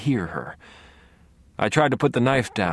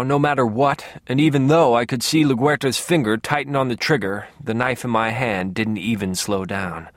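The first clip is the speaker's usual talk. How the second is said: ordinary delivery, nothing marked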